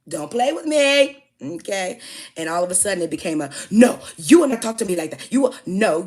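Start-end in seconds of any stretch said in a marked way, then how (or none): 0.1-2.0 much higher, louder and slow, each word hit; rising shouted warning. Retold or imitated. Warning the caller, repeated harder. 3.7-6.0 loud, higher scolding; forceful scolding shout; angry telling-off. Retold or imitated. Retold telling-off of caller.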